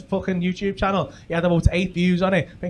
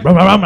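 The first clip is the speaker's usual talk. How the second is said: loud mock scrambler rev; revving engine imitation; 11 dB louder than usual. Street Fighter standoff with the scally, loud engine rev. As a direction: rev hard before the charge. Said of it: "he's like".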